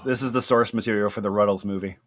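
ordinary delivery

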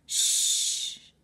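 a breathy hushing shh; whispered shush. A narrator's reading. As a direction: hush her without voice. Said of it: "Jack put his finger to his lips".

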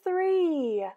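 ordinary delivery, nothing marked

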